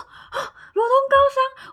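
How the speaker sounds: 高八度地惊叫“罗东高商”; 尖声惊呼，像要叫出来; shocked squeal. Retold or imitated. Shocked recognition of school. (认出学校时尖声惊叫)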